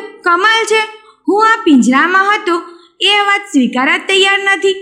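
a lightly voiced tiger who can't believe his ears; amazed tiger. Tiger hears the fox doubt he fit the cage, astonished.

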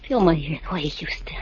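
low, pleading whisper; seductive whisper. Acted. Kitty whispering to Nicky to calm him down.

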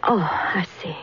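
breathy, whispered, near crying; holding back tears as she takes it in; tearful whisper. Acted. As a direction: shaken whispered oh I see. Daughter hears of the death.

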